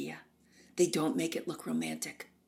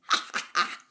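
staccato, breathy "ha, ha, ha"; mock diva laugh; 4 dB louder than usual. Norma laughs after her threat, mock staccato cackle. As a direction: imitate a grand villainess's laugh. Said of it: "She laughed".

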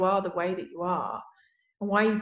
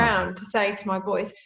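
ordinary delivery

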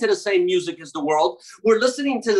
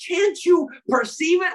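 much higher, pleading challenge; emphatic challenge; 9 semitones higher than usual. Retold or imitated. God urging his people, a high emphatic challenge. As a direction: lift it up high and press the question. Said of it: "I love that when he's like".

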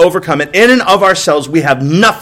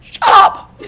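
mock shriek. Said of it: "you're like".

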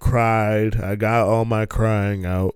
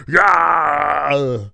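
put-on loud cracked old-lady yell; old lady's cheer; 3 semitones higher, 5 dB louder than usual. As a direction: cheer like a scarred old woman in the crowd. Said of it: "and she's like".

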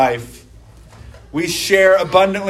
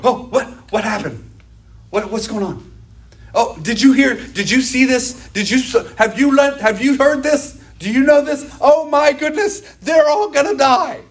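higher, breathless mock panic over news; breathless news chaser; 7 semitones higher than usual. Preacher mimics someone frantic over breaking news, mock-panicked. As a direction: gasp it out like a gossip hooked on headlines. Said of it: "You hear some news and you go".

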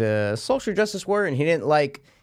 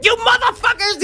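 high, loud hammed-up yell; mock yell; 6 dB louder than usual. Describing actor's typical scene, high mock shout. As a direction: scream it like a cornered little villain. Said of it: "there's always a time where he's like".

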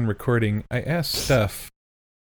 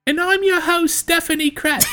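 much higher, squeaky mock voice of his co-host; impression of Stephanie; 4 dB louder than usual. Co-host mocking how his host sounds, high falsetto impression. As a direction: mimic her in a high falsetto. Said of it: "is what I do".

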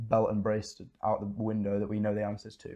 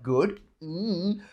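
imitating doubtful client; 6 semitones higher than usual. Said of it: "you're like".

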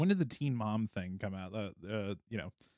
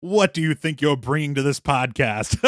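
wrestler voice; 5 semitones higher, 11 dB louder than usual. Acted. Wrestler persona taunting host.

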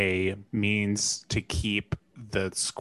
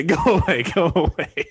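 higher, louder panicked plea; mock scared; 6 semitones higher, 7 dB louder than usual. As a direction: cry it out in hammed-up fright. Acting someone scared, curled up.

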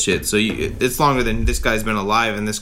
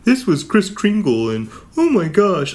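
higher, slow, sugary mocking voice; mocking cutesy coach; 5 semitones higher than usual. Fan mocking Matt Nagy's cute team stunts, slow high cooing. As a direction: coo it like a coach playing Santa games. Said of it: "nobody likes his cute shit".

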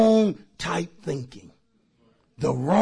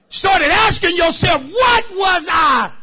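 high, loud preacher's shout; exasperated self-questioning; 9 semitones higher, 6 dB louder than usual. Acted. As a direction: cry it out, stretching the last words. Acted self-questioning, shouted to congregation.

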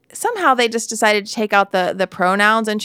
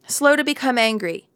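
ordinary delivery

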